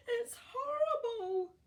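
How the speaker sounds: high, loud, slow scream of horror; terrified cry. Acted. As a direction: scream in terror at the giant snail. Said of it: "they cried".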